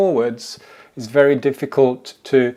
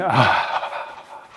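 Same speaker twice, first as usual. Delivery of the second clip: breathy ah exhale. Shown as a demonstration. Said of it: "it might be an ah".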